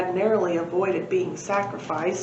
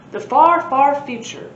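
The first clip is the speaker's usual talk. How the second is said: grand announcement; 6 semitones higher, 7 dB louder than usual. Acted. Professor announces destination.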